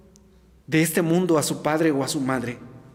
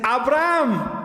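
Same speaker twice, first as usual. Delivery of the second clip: calling voice from heaven; 5 semitones higher, slower than usual. Acted. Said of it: "hay voces del cielo que le dicen".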